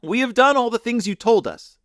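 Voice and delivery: put-on children crying out to the girl. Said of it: "the children cried again".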